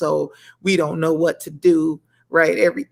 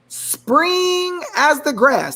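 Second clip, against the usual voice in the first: slow, higher preacher reading; preaching scripture; 7 semitones higher, much slower than usual. Acted. Host preaching psalm to viewers, slow and lifted. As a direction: stretch each word like from the pulpit. Reads verse preacher style.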